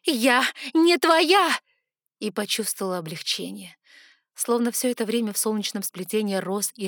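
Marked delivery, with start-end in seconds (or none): 0.0-1.5 high, loud, slow defiance; defiant shout. A narrator's reading. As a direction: hurl the refusal at her. Defying the goddess.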